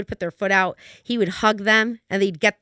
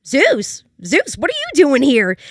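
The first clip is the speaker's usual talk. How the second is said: a high, louder cry of surprise at the dog; surprised; 5 semitones higher, 5 dB louder than usual. Retold or imitated. Veronica surprised to see Zeus at her door, high and louder. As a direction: call out the dog's name in delighted disbelief.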